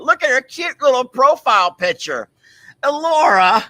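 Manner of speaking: high and loud, delighted over a cute picture; gushing. Real, not acted. Grandpa gushing over granddaughter's profile picture, delighted. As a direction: gush over the sweet photo.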